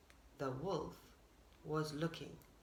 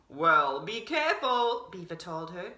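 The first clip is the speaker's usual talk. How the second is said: lower, louder beaver warning of a wolf; 6 semitones lower, 5 dB louder than usual. A narrator's reading. Beaver warns Deer of the wolf, low and serious. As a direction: warn her in a deep beaver voice.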